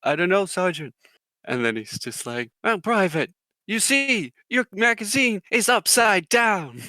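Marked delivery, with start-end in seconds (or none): 0.0-0.9 lightly voiced recruit answering up; higher-pitched imitation of a private replying to his sergeant. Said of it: "He's like". 2.6-6.8 high, loud mock sergeant telling-off; drill sergeant. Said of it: "the drill sergeant's like".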